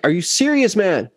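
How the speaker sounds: retold indignant complaint; peeved. Bassist confronting the sound guy over his gear, peeved. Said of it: "I was peeved".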